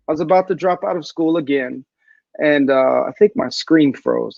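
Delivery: usual speaking voice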